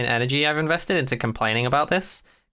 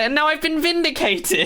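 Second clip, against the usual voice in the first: triumphant gloat; 14 semitones higher than usual. Real, not acted. Gloating after a long rant.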